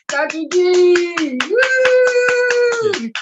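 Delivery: high, loud, stretched whoop; cheering. Real, not acted. Fan cheering on Doctor D, high excited whooping. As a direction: holler it out like a fan.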